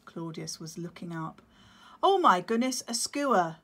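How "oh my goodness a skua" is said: loud, higher startled gasp of alarm. Acted. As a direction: react as danger appears. Sudden alarm at predator.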